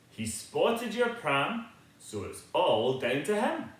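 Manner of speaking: usual speaking voice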